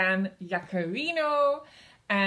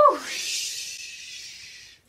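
whooshing sound; 5 semitones higher, 5 dB quieter than usual. Acted. Wrecking ball swings down.

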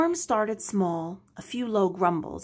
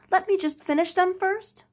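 high, loud desperate plea; pleading cry; 11 semitones higher, 4 dB louder than usual. Acted. Henry pleads to keep his books, high and loud. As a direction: beg them to wait. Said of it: "cried Henry".

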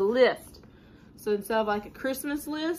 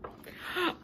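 sharp excited gasp; 8 semitones higher, 6 dB quieter than usual. Acted. Pigeon gasps before puppy.

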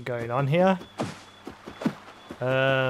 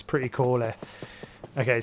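ordinary talk

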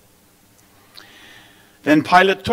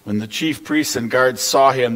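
ordinary delivery, nothing marked

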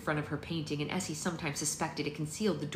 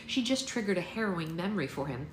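usual speaking voice

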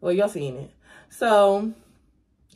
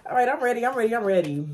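usual speaking voice

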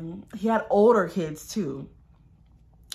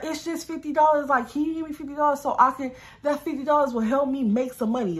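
pleading man; 6 semitones higher than usual. Retold or imitated. Voices the man pleading for money.

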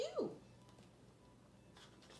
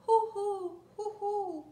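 owl hoots; 8 semitones higher, 6 dB louder than usual. Shown as a demonstration. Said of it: "He can go like an owl".

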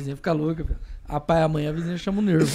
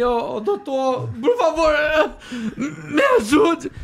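high, loud, drawn-out plea; pleading with the doctor; 9 semitones higher, 3 dB louder, much slower than usual. Retold or imitated. Patient begging the doctor for help, high pleading cry. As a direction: beg the doctor for help, stretching ajude. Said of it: "Eu falei".

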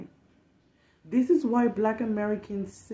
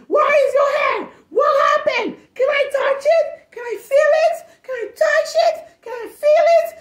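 put-on much higher, loud squealing children; excited kids; 9 dB louder than usual. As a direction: shriek questions like overexcited kids. Kids swarming over her wig.

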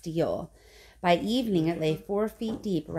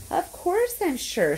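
high, drawn-out excited insistence; excited splutter; 9 semitones higher, slower than usual. A narrator's reading. Grandpa Joe assures Charlie he's sure, excited high splutter. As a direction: insist excitedly, pitch leaping up. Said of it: "spluttered the old man excitedly".

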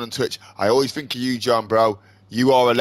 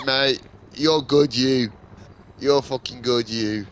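usual speaking voice

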